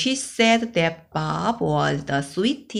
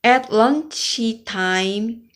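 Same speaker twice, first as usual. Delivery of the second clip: plain reading voice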